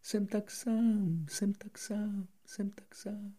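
high slow echo fading to a whisper. Acted. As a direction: let the echo die away. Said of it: "opakovala ozvěna". (slábnoucí ozvěna)

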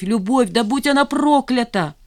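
high cursing outburst; feverish agitation. A narrator's reading. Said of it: "Её трясло, словно в лихорадке". (в лихорадочном волнении, с надрывом)

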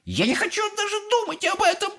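fast, very high-pitched shriek; wailing. A narrator's reading. Mother refusing to picture it, wailing. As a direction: squeal it hysterically. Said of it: "вопила миссис Глуп".

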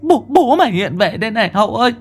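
high frightened calling for help; terrified cry. Acted. Mother crying out to daughter in fright, high and urgent. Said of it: "Bà hét lên".

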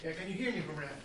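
distant, off-mic voice; speaking from across the room; calling from far away. Real, not acted. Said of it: "we can't hear you speaking too well from there".

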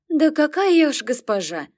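high, loud flustered protest; blushing and pleased; flattered. Acted. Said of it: "Мама раскраснелась".

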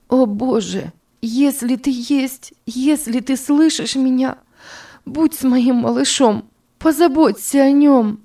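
high, rising to a loud plea; mother prays for her baby; desperate prayer. A narrator's reading. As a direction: beg God for the child.

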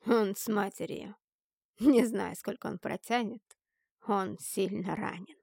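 lightly voiced sneering man, slowing at the end; mocking. A narrator's reading. Captor reporting that he is badly hurt, lightly mocking. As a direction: answer with a touch of mockery. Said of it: "с насмешкой проговорил мужчина".

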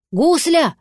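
calling out. A narrator's reading. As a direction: call for your missing child. Cat calls for the missing dragon.

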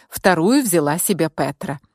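ordinary delivery, nothing marked

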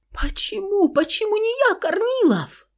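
very high wailing question; almost in hysterics, pleading; hysterical. Acted. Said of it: "явно почти в истерике".

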